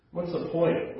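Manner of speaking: ordinary talk, nothing marked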